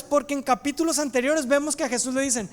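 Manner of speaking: ordinary talk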